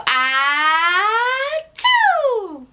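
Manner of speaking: high playful fake sneeze "Ah, choo"; squeaky pretend sneeze for baby; mock sneeze. Shown as a demonstration.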